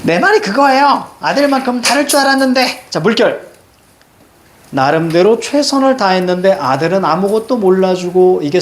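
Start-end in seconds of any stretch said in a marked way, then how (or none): 0.0-2.8 high, emotional outburst; acted high-pitched lament; aggrieved agreement. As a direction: burst out high that you finally feel understood. Voices mother agreeing, upset.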